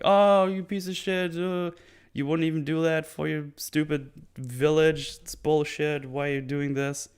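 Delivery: high-pitched indignant rant, imitated; mock cussing out. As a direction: act out a whiny, scolding tirade. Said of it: "starts cussing him out again".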